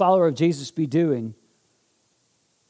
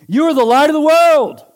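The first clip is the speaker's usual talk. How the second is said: much higher, loud proclamation; fervent preaching; 12 semitones higher, 9 dB louder than usual. Real, not acted. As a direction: declare it with full conviction. Preaching climax.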